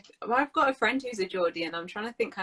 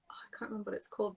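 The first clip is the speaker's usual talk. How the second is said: whisper to self; 11 dB quieter, much faster than usual. Real, not acted. Trying to recall a name.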